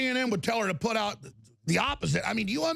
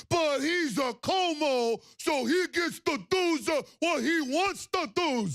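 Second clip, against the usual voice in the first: high, slow, sneering mock voice; host sneering at a spoiled brother, slow mock voice; mocking entitlement; 7 semitones higher, slower than usual. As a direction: drag it out with contempt.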